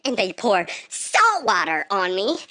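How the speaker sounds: ordinary talk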